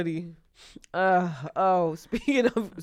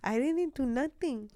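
put-on higher, softer little-girl innocence; innocent kid act; 6 semitones higher, 8 dB quieter than usual. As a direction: play it sweet and guiltless. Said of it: "sitting there like".

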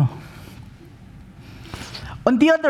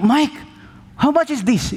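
high, lightly voiced foreign guest; a hint of an eager Swiss visitor; Swiss guest asking; 9 semitones higher than usual. Swiss pastor asks his driver the price, lightly voiced.

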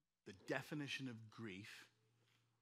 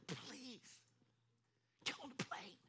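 tearful plea; 12 semitones higher, slower than usual. Retold or imitated. Begging dad, both crying.

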